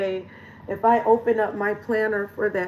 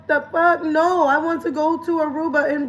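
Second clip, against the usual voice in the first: high, louder what-the; higher shocked the fuck; incredulous; 6 semitones higher, 3 dB louder than usual. Real, not acted. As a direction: scoff in disbelief. Reacting to metaverse idea.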